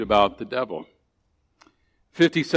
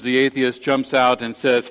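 ordinary talk, nothing marked

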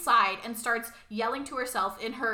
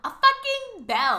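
much higher, louder, slow incredulous outburst; outraged disbelief; 11 semitones higher, 8 dB louder, slower than usual. Real, not acted. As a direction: stretch it out, appalled. Outrage at the servant bell.

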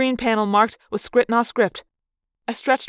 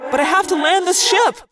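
high, strained-for-time shout; insistent high-pitched call; urgent yell; 9 semitones higher, 4 dB louder than usual. A narrator's reading. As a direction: yell it while fighting the controls. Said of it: "I yelled".

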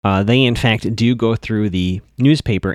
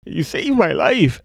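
higher, drawn-out tearful wail, imitated; mock crying; 6 semitones higher, slower than usual. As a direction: imitate a tearful, slow, high-pitched outpouring. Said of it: "just crying her eyes out".

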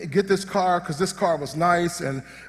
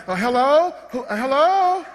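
high, rising, mock-timid calling out; horror-movie victim; 8 semitones higher than usual. Preacher mimics movie people searching the house, high searching call. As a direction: call into a dark house in a comically higher voice. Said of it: "like the people in the movies walking around".

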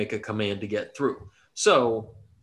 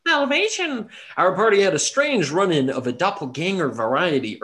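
hype announcer; 5 semitones higher, 4 dB louder than usual. Acted. Hyped podcast host opening.

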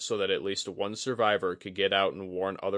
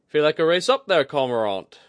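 high, loud teasing challenge; Kudaka, needling; 8 semitones higher, 10 dB louder than usual. A narrator's reading. Kudaka needles Oguri into a race, teasing. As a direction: toss out a playful dare. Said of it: "She needled him gently".

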